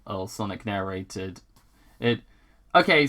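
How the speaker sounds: ordinary delivery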